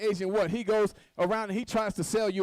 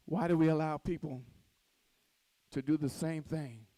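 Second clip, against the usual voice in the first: quiet reflective preaching; 6 semitones lower, 4 dB quieter than usual. Acted. Preacher speaking softly to the congregation about hurt. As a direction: draw the room in close and gentle.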